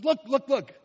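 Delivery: high, urging "look, look, look"; elder pressing John to turn and look; urgent call. Retold or imitated. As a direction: keep pointing his gaze, insistently. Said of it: "He says".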